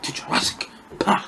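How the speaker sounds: breathy near-whisper, awed; hushed movie quote. Retold or imitated. Reviewer quoting the famous Jurassic Park welcome, whispered in awe. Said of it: "the first time you see the Brachiosaurus".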